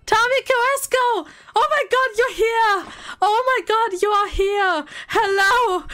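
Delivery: high, excited greeting; thrilled. Real, not acted. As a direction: cry out, delighted the winner showed up. Winner finally turns up in chat.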